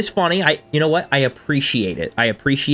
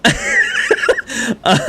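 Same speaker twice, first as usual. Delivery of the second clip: high burst of laughter; 14 semitones higher, 3 dB louder than usual. Real, not acted. Laughing at own social blunder.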